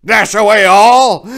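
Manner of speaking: loud, high ringing shout; Santa's command call. Acted. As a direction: call the team to fly. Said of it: "Santa had given the command".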